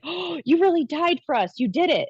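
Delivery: high, gasped "oh" easing downward; retold amazement, high-pitched and breath-caught; awed realization. Woman recalling seeing Jesus's wounds, awed.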